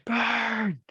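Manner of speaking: high, drawn-out playful cry; mock-yelling sun. The sun scorching everyone, high mock yell. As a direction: stretch it out high, like a gleeful sun. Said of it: "the sun just yelling".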